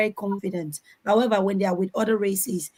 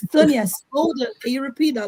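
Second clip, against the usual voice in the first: acted, higher-pitched plea to repeat; imitated interviewer interrupting; 5 semitones higher than usual. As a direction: imitate an interviewer cutting in on a gabbler. Acts interviewer interrupting her fast babble.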